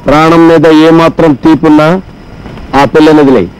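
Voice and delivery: very low, monotone movie-hero challenge; tough-guy threat. Pretend. Sidekick challenges a man to release the girl, menacing. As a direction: play the dangerous rescuer, deep and measured. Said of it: "dialogue part వరకే మందండి".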